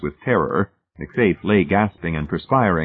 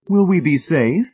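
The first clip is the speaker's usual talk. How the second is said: high, louder worried question; apprehensive; 11 semitones higher, 5 dB louder than usual. A narrator's reading. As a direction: ask as a mildly apprehensive lady. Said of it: "Missus Pritchett looked apprehensive".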